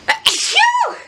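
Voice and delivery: loud high achoo sneeze; sneezing. Real, not acted. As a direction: let a big sneeze out. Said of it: "I usually do the achoo noise".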